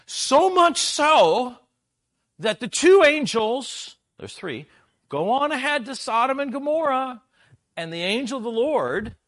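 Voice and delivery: rising to loud, high preaching at full force; a preacher driving home that it was never about the tea. Acted. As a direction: drive the point home from the pulpit. Preaching builds again.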